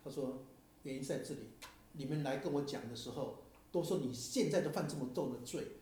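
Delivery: ordinary talk, nothing marked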